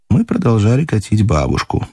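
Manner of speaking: plain reading voice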